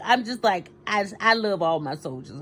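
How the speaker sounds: ordinary delivery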